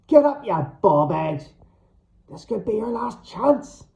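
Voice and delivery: loud yell, rising high; furious wake-up shout; screaming. A narrator's reading. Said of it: "I screamed".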